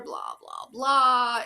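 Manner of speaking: mocking blah blah, last one shouted; dismissive.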